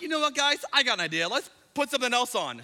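high, loud, over-bright suggestion; fake-cheerful dad. Dad distracting his kids from the movie, acted cheeriness. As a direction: hastily sell the kids a new idea.

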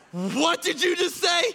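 high, slow, aghast disbelief; stunned, can't believe what he heard; horrified. Retold or imitated. Mortified friend reacting to Tim, high horrified disbelief.

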